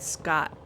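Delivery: "Scott" said as "Scat"; name with an A. Shown as a demonstration. Gamer showing how the name is pronounced, "Scott" with an A. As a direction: open A in "Scott", not an O. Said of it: "It has to be pronounced like that".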